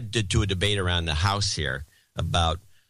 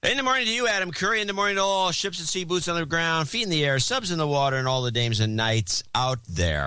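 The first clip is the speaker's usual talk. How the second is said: high, fast announcer salute to the audience; signature greeting; 7 semitones higher than usual. Acted. Co-host returns the show's signature greeting, high and fast announcer voice. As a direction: boom it out like a ship's broadcast.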